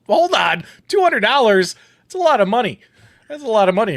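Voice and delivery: shouting it out, high and loud; real amazement at the prize money; excited surprise. Real, not acted. Shocked at the two hundred dollar prize.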